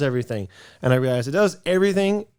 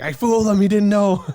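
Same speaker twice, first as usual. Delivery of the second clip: much higher, louder gloating glee; gleeful triumph; 11 semitones higher, 5 dB louder than usual. Retold or imitated. Recalling her glee at surprising her partner, high and loud. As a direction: crow about pulling off the surprise. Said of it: "I remember I was like".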